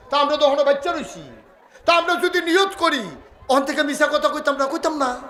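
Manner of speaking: high, urgent exhortation; impassioned preaching. Real, not acted. Preacher urging the congregation to resolve against sin, high and impassioned. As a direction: drive each pledge home on a high, insistent pitch.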